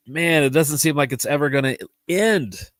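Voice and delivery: usual speaking voice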